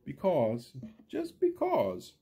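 lively storybook read, big rises and falls; reading to children. A narrator's reading. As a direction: read it like story time for little kids. Story's closing lines read aloud.

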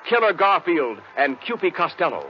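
ordinary talk

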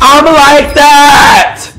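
long high triumphant yell; drawn-out victory scream; hype shout. Real, not acted. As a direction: shout it out after the kill. Hype after kill.

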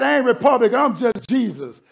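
usual speaking voice

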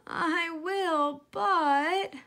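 high, halting little pig voice; hesitant piglet. Acted. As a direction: stall the fox politely. Piglet voice in story.

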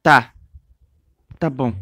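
normal talking voice

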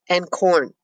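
bored, flat voice, clearly unimpressed; monotone. Acted. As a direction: toss it off without any interest. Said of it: "she says in a monotone".

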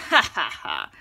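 low, loud ha ha ha; hearty driver laugh. Acted. Driver laughing at Henry in front of the bus, low and loud. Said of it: "The bus driver laughed".

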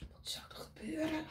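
hushed suspense whisper; breathless storyteller whisper. Shown as a demonstration. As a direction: whisper it to build suspense for the kids. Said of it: "unvoiced suspense question".

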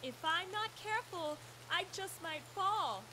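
high, soft fluttery damsel; hammed-up helpless sigh; damsel in distress. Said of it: "pretending to be a damsel in distress".

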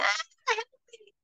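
sudden high desperate yelp; panicked cry for help. Pretend. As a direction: cry out like someone drowning. Drowning man calls out.

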